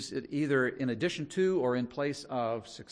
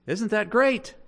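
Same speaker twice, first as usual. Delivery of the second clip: enthusiastic; 9 semitones higher, 10 dB louder, slower than usual. Acted. Preacher's rhetorical cheer.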